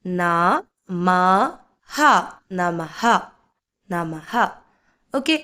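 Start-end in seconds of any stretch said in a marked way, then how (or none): 0.0-4.3 ന, മ, ഹ separately, then joined low as നമഹ; syllable-by-syllable pronunciation. Shown as a demonstration. Teacher sounding out a word for learners, നമഹ syllable by syllable. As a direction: spell out "na, ma, ha", then blend into നമഹ. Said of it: "ഇതിനെ എങ്ങനെ ഉച്ചരിക്കാം?".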